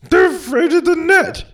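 squeaky high joke voice; high-pitched punchline. Acted. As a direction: pop the punchline up high and loud.

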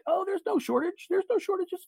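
high mocking imitation of the president; Biden impression. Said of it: "'cause Biden said it the other day that".

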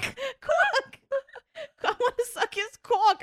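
high, hammed-up Jersey screech; screaming. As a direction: re-enact shrieking it across the house. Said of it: "and we're screaming".

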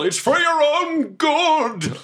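high-pitched, drawn-out holler; mock yell. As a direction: yell it with theatrical strain. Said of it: "and I just yell".

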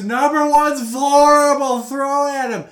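high, slow, booming holler; heckler's yell. Retold or imitated. Retelling the heckler's modified chant, yelling. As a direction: imitate a fan bellowing advice down to the field. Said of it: "he eventually modified it to".

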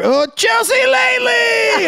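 high, loud, slow excited shout; excited recognition. Retold or imitated. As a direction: shout it like a thrilled fan. Drive-thru guy recognizes him.

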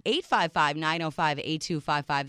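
ordinary talk, nothing marked

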